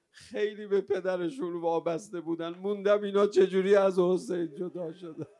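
loud, high mourning cry, building in force; wailing lament. Real, not acted. Preacher grieving children parted from Hussein, wailing lament. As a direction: cry out the grief, swelling to a wail.

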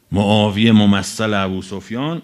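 usual speaking voice

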